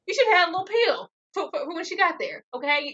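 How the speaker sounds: ordinary talk, nothing marked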